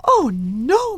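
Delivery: shocked gasp. Acted. Shocked servant.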